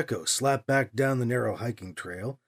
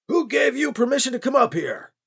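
higher, irritated shout up the trail; 8 semitones higher than usual. A narrator's reading. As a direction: bark the question, annoyed. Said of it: "the man shouted, irritated".

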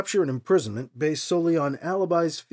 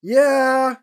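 higher, louder yeah called downhill; calling out; 9 semitones higher, 7 dB louder than usual. A narrator's reading. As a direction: call it across. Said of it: "Alibi called out".

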